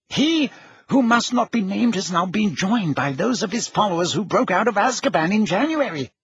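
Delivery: agitated, high-pitched rush of news; increasingly rapid. A narrator's reading. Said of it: "speaking more and more rapidly".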